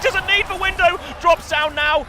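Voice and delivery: high, loud hype play-by-play; excited esports caster. Real, not acted. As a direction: yell the clutch play. Hype casting of teamfight.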